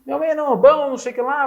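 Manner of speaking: imitating his own stunned reaction; mock disbelief on the phone; retold shock.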